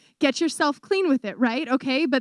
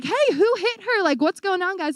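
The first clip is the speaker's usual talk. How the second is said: mock alarmed questioning; 7 semitones higher than usual. Acts out confronting the children.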